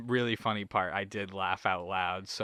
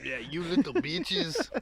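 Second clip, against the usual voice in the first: mock villain taunt; 6 semitones higher, slower than usual. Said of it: "I'm ad-libbing".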